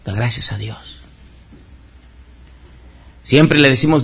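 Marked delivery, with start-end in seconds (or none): 0.0-1.0 soft whisper of "Da gracias a Dios". Real, not acted. Preacher hushing to urge the congregation, whispered. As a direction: drop to a hushed whisper. Quiet aside after loud preaching.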